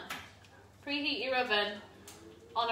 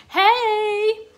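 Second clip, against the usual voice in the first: excited hello; 9 semitones higher, 6 dB louder than usual. Real, not acted. Spotting a viewer comment.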